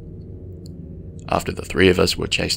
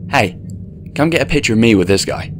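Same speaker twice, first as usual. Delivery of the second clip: cocky show-off; 5 semitones higher, 5 dB louder than usual. A narrator's reading. Cocky guy showing off.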